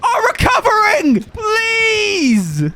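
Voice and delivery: high cracking yell of disbelief; angry shout. Real, not acted. Gamer yelling at teammates, high cracking shout. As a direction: scream at the team, voice breaking. Streamer raging at downed team.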